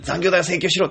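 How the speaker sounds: high, loud mock order to quit; mock-urgent order to quit the job; urgent command. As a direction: insist loudly, half joking. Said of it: "って言いますけど".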